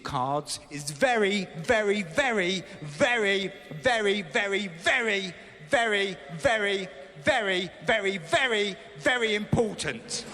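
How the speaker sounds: milk the very over and over; building emphasis. Acted. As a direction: stretch out very very very for the laugh. Comic build on repeated very.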